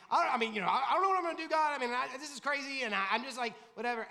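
high, loud, panicked rush with a nervous laugh; a frantic man blurting out his fear; panicky Moses. Acted. Preacher voicing a scared Moses, high and loud in panic. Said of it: "Moses is like".

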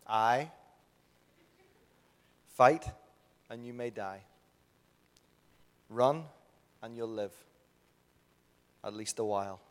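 loud, slow rallying speech with long pauses; rally the troops, phrase by measured phrase; famous film battle speech. Acted. Preacher reciting Braveheart to the congregation, slow rousing battle speech. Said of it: "it's the famous speech".

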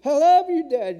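desperate shout rising to a near scream; screaming love. Retold or imitated. A son screaming from the truck window to his dying dad, desperate full-voice shout. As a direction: cry it out as loud as you can. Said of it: "I was screaming down there".

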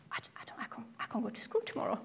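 hushed, broken whisper; whispered despair. Retold or imitated. Scared girl back home dreading school, whispered. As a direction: breathe it out as a scared kid. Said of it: "I was like".

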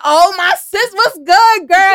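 high, loud, excited "what's good"; excited girlfriend greeting. Retold or imitated. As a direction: greet your sister-friend with big energy.

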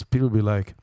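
ordinary delivery

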